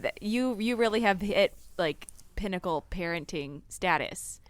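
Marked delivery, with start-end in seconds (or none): none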